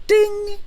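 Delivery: high sung phone ding; imitated ding. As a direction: chime like a phone alert.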